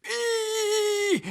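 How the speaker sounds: high squeaky little mouse cry; mouse squeak. Acted. Elf turned into a mouse.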